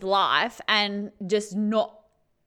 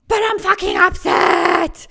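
hammed-up furious shout, higher and louder; dramatized outburst; 6 semitones higher, 8 dB louder than usual. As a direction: blurt it out as if fed up with being told to calm down. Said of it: "I'm just trying to dramatize this".

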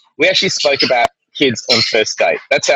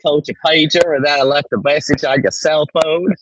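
Australian attempting a Southern US accent; imitated Southern accent. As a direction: have a playful go at a Southern accent. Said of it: "trying to do a Southern accent".

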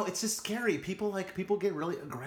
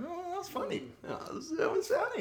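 mock-praising the joke in a much higher, falsetto voice; sarcastic; 3 dB quieter than usual. Co-host mocks the bad Georgia pun, high sarcastic falsetto. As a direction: sneer at the pun with squeaky fake delight.

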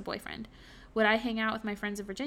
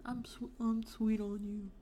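slow, softer country-accent imitation; attempted deep country accent; 5 dB quieter, slower than usual. Retrying the boyfriend's pet phrase, badly imitated country accent. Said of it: "like, a deep country accent".

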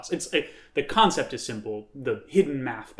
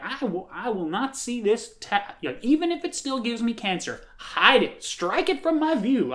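higher-pitched entitled LA Karen complaining at a county board; mock Karen voice; 9 semitones higher than usual. Mocking Karen at the county board, higher voice. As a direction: play the fussy NIMBY resident who won't have it in her view. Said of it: "I don't know why this accent".

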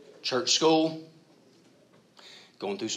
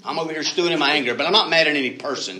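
louder, fast sarcastic rant; mock stewing anger; 5 semitones higher, 7 dB louder, faster than usual. Preacher mocking believers hiding their anger, loud and fast. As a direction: rattle off the self-justification.

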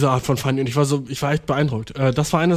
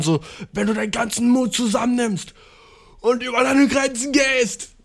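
Dragon Ball Z pep talk; 8 semitones higher than usual. Retold or imitated. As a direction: push them past their limits like an anime mentor. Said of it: "Dragon Ball Z Pep Talk". (Dragon-Ball-Z-Motivationsrede)

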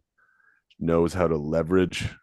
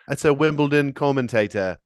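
higher lightly British commentator voice; 7 semitones higher than usual. Pretend. Co-host explains his bit in a British commentator accent. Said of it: "What is that accent?".

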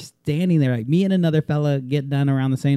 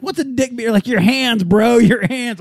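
high-pitched bro banter; hyped-up gamer voice; mock gamer bro; 9 semitones higher than usual. Friends in a fist-only video game, hyped bro banter.

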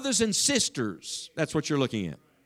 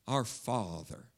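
soft and slow, much quieter and lower, almost breathed; tender, hushed; 8 semitones lower, 9 dB quieter, slower than usual. Shown as a demonstration. Preacher showing the congregation how to begin prayer, hushed and tender. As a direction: say it like a child to a loving dad. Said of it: "what a tender expression that is".

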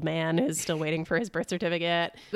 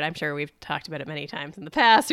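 normal talking voice

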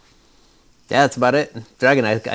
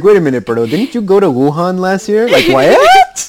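shocked accusing friend, much higher and louder; mock outrage; 8 semitones higher, 11 dB louder than usual. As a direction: squeal it like a stunned friend.

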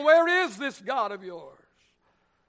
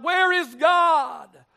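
high, slow, loud cry; screamed question; 7 semitones higher, 6 dB louder, much slower than usual. Pretend. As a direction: cry it out like a wound. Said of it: "the nagging question seems to scream out".

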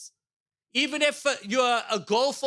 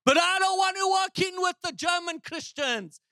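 put-on raised, ringing German-accented proclamation; German preacher's shout; 7 semitones higher than usual. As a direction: mimic a German evangelist crying out. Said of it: "He would always say".

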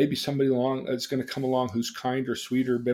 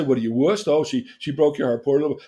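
loud mocking taunt; mock pity, louder; teasing; 7 dB louder than usual. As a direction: imitate friends jeering at a crybaby. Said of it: "They'd tease me".

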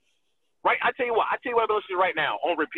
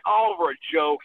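ordinary talk

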